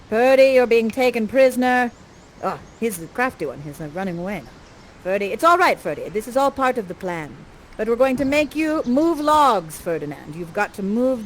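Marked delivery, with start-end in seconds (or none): none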